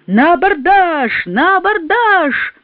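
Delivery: slow, drawn-out battle cry; frantic shout. A narrator's reading. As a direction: yell it like a madman charging. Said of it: "и он неистово кричал".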